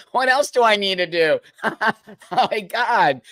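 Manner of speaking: usual speaking voice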